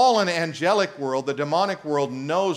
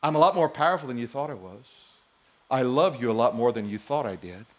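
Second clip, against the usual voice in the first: lightly voiced God, lower and softer, tender; 6 semitones lower, 3 dB quieter, faster than usual. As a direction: speak as a gentle loving God, low and quiet. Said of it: "the Spirit of God says".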